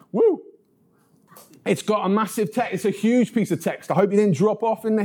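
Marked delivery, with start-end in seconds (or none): 0.1-0.4 high joyful whoop of relief; excited whoop. Real, not acted. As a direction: let out a bright woo. Relief after long reading.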